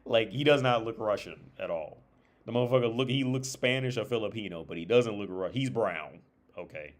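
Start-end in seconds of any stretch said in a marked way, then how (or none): none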